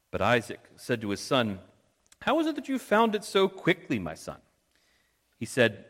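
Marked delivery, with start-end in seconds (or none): 2.2-4.4 high-pitched questioning voice, dropping on the last words; questioning old father. Acted. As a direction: play a puzzled father in a raised voice. Voicing old Isaac questioning his son.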